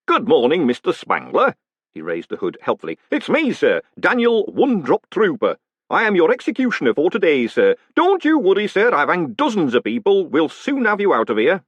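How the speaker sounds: high, loud, chummy executioner; cheery hangman. Acted. Hangman introducing himself to the condemned man, cheerfully. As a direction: greet him like an old friend at work.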